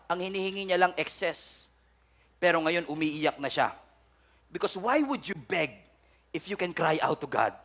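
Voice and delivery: ordinary talk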